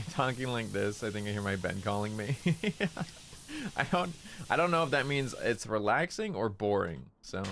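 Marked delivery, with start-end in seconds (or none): 2.4-3.7 high-pitched giggle; amused laugh. Real, not acted. Streamer laughing about hearing their bed calling, high giggle. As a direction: giggle lightly, well above the talking voice.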